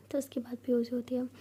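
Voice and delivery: usual speaking voice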